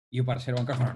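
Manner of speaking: usual speaking voice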